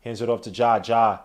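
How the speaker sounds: sports announcer calling the game; live play-by-play. Acted. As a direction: call it like a broadcast announcer.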